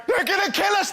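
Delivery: high, loud mock panic yell. Pensioner fearing a self-driving car, mock panicked yell. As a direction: squeal it like a frightened pensioner. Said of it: "I can still feel prejudice going".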